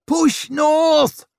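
full-throated high battle shout; roar. A narrator's reading. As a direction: roar the order across a crowd of men. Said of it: "he roared at the men".

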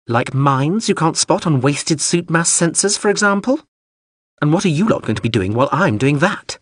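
high, snide machine voice; sarcastic drone. Acted. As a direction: needle them as a huffy drone.

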